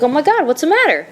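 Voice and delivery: high, worried "what's the matter"; alarmed question. Retold or imitated. Asking her crying husband what's wrong, alarmed. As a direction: rush in with concern.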